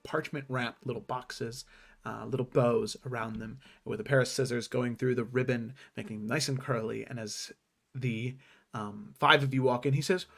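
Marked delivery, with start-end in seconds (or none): none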